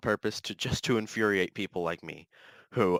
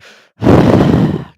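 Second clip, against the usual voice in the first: a loud, hot, heavy exhale through the mouth; big angry sigh; 16 dB louder than usual. Shown as a demonstration. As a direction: blow out a big fed-up sigh. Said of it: "do some big sighs".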